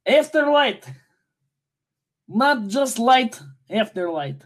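put-on amateur Russian accent. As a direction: announce it in a mock Russian accent. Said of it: "I am doing it in Russian now".

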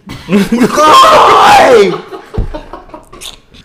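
high, shouted burst of laughter; loud laughter. Real, not acted. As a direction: crack up loudly and high. Cracking up at a joke.

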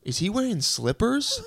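high, slow incredulous question; drawn-out high mocking query; overheard woman. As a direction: imitate a woman roasting someone. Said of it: "then I hear her go".

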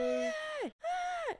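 very high, piercing "ah" noises; imitated cries. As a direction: mimic high-pitched cries from the water. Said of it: "I started hearing, like".

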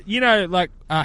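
ordinary delivery

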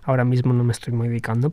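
ordinary delivery

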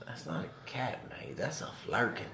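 put-on hushed stab at an Australian accent. Retelling a film line to a friend, attempted Australian accent. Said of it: "trying to do an Australian accent".

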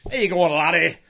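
put-on comic Scottish fighter accent. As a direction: put on a Highlander's Scottish burr. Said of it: "I can go to Scottish as well".

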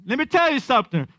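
picture Peter declaring it with fire; bold Peter. Retold or imitated. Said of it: "I could picture Peter saying".

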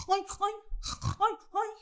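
high, slow squealing pig cry; drawn-out high piggy oinks. Acted. Pig calls the sheep.

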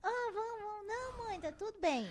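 put-on high whining child voices; mock children whining; whiny kids. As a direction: whine like a tattling kid. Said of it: "eles podem estar se pegando".

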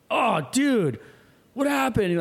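high-pitched startled exclamation; retold alarm. Terrence on the boat asking what happened, high alarm. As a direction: exclaim it high, in mock shock.

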